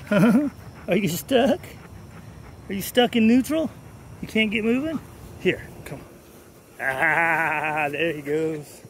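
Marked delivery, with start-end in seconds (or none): 0.1-5.0 high, drawn-out teasing coo. Real, not acted. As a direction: tease the dog stuck in place, stretched and high. Teasing the slow dog.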